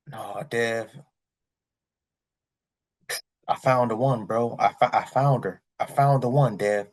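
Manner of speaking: a hint of a heartbroken buddy; lovesick friend. Friend back from Japan telling Dev he's in love, mildly mopey. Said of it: "came back depressed".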